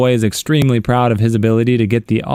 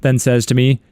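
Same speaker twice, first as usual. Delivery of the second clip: ordinary delivery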